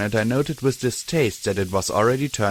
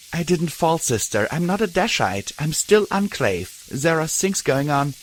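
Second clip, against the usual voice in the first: brittle, heartbroken; 6 semitones higher than usual. A narrator's reading. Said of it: "in a brittle, heartbroken voice".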